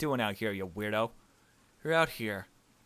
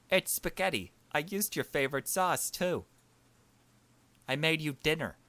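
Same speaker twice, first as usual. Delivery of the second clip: higher, sweetly coaxing voice; mock sweet coaxing; 6 semitones higher than usual. Player sweetly coaxing the cousin inside with spaghetti, higher voice. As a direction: coax him inside with food.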